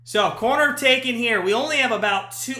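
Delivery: ordinary delivery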